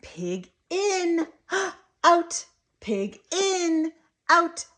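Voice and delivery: put-on high, punchy toddler play voice; lilting, emphatic word-by-word play talk; excited modelling. Shown as a demonstration. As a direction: gasp and pop each word out loudly. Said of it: "So you're gonna say".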